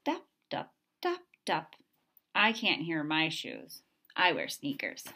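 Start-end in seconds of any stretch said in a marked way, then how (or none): 0.0-1.7 high, clipped dup sounds; mimicked footsteps. A narrator's reading. Reading to a child, imitating father's shoes, high and light. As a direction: tap out each dup like a footstep. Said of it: "he goes".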